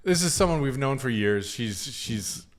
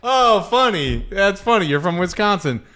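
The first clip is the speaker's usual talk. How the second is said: forced polite amusement; 6 semitones higher, 5 dB louder than usual. Retold or imitated. Mock politeness to a girlfriend's mother's Wisconsin accent. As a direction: humor her with strained politeness. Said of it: "and I'm like".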